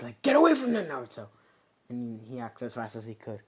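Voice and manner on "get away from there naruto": high, loud yell, dropping on the name; acted alarmed yell; urgent warning shout. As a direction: shout to get someone out of danger.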